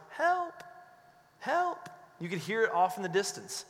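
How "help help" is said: high, faint call of help from far off; a small distant voice calling for help; imitating a faint distant voice crying help. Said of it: "this little faint voice going".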